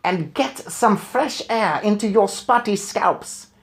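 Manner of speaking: put-on high, slow, loud shout rolling the R in a foreign accent; Grand High Witch shouting. A narrator's reading. Witch leader shouting at her bald witches. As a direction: spit the words out like a rasping hag. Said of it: "she shouted".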